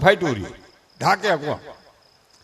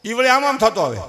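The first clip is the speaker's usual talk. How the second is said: usual speaking voice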